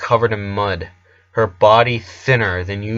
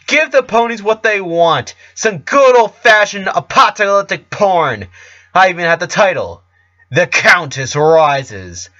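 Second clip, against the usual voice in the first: a scheming diva alter ego, building higher and louder into a frenzy; ranting Countess; 5 semitones higher, 4 dB louder than usual. Acted. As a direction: start sly, then rant louder and higher toward the climax. The Countess rants at Rara.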